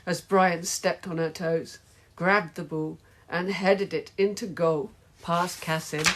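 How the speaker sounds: usual speaking voice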